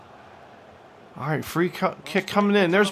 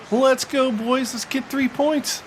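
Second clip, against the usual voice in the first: excited cheer; 8 semitones higher, slower than usual. Real, not acted. Cheering on his team.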